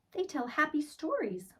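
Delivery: a hint of a bright child; lightly voiced little girl. Laurel explaining rugs to the sad man, lightly girlish. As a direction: lift it up for the girl.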